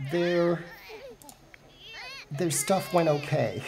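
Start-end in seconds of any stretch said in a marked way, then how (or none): none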